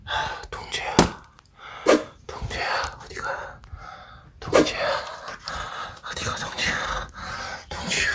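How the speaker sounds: soft unvoiced pretend sob; breathy fake crying after the dog; mock tearful whisper. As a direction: whisper like a hammed-up heartbroken owner.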